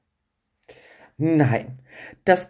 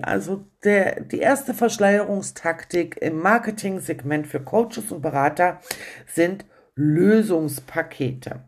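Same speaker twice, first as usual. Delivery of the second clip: usual speaking voice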